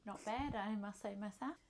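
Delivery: ordinary talk, nothing marked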